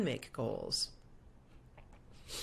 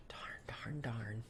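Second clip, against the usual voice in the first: softer, whispered darn; whispered annoyance; 7 semitones lower, 13 dB quieter, much slower than usual. Real, not acted. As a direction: mutter under breath, annoyed. Lost the prayer request tab.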